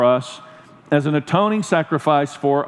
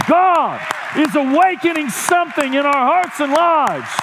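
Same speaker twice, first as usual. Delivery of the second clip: raised, shouting sermon climax; fiery preaching; 6 semitones higher than usual. Acted. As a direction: preach it hard to the room. Preaching at full force.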